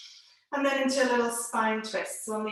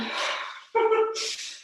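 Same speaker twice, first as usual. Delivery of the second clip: light laugh; 11 semitones higher than usual. Real, not acted. Laughs at blaming the children.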